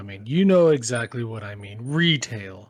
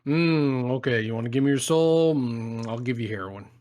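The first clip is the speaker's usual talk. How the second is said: mock devil. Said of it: "I'm the devil".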